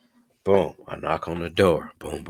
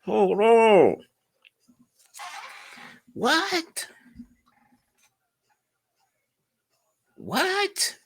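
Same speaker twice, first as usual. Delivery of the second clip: high-pitched disbelief; much slower than usual. Retold or imitated.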